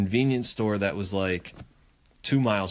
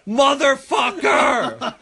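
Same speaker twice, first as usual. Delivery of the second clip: slow, drawn-out yell, much higher and louder; furious shout; 13 semitones higher, 7 dB louder, much slower than usual. Retold or imitated. Capping the mower story with a drawn-out yell. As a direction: roar it out in disbelief.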